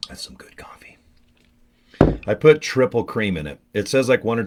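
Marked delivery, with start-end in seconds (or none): 0.1-1.0 a hushed aside over the cup; whispered. Real, not acted. Whispering to himself about the good coffee.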